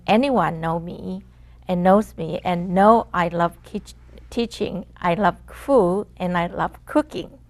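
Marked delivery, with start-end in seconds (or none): none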